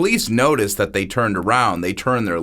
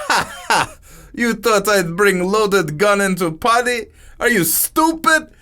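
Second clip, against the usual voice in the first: put-on much higher accented voice, cackling then scoffing; mocking laugh; 10 semitones higher than usual. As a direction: gloat over the cruel joke. Niku laughing at his prank.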